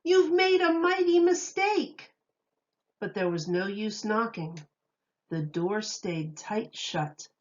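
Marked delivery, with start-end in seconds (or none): 0.0-2.1 high, loud indignant roar; offended lion still roaring. Acted. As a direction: keep roaring through the door. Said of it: "he roared".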